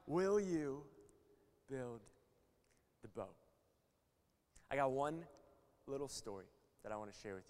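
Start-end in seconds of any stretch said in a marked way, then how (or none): 0.1-3.3 WILL YOU, then BUILD, then THE BOAT, long pauses between; slow, weighty question. Real, not acted. Closing question after the climax.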